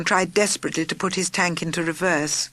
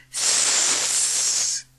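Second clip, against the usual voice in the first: a thin nervous snake hiss. A narrator's reading. Snake nervously hissing at journalists. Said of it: "hissed her most nervous".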